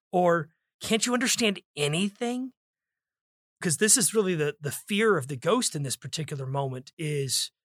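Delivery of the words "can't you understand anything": raised pitch with weight on "anything"; stress on ANYTHING. Shown as a demonstration. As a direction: punch "anything" as an exasperated question. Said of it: "it's about how you say it".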